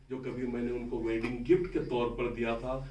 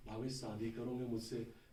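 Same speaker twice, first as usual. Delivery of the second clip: retold proposal, low-key and much softer; hushed proposal; 3 semitones lower, 11 dB quieter than usual. Friend proposes marriage to him at the stall, hushed. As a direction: drop the voice right down for the question.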